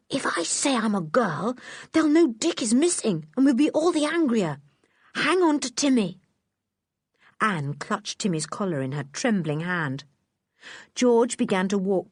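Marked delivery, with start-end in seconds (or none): none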